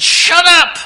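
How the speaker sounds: loud high snapped shout; harsh rebuke. Retold or imitated. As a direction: shut him down hard. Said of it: "the disciples said".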